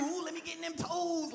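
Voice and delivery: lightly voiced leering man; foot dude impression. Comic mimicking a creepy foot guy, leering mock voice. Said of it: "been with a foot dude?".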